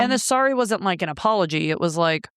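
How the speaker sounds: usual speaking voice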